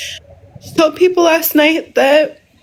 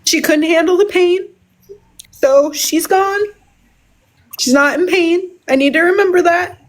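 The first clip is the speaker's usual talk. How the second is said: grieving, voice lifted higher; choked up with real grief; real emotional, near tears; 4 semitones higher than usual. Real, not acted. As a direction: hold back tears through the loss. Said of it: "excuse me while I get emotional".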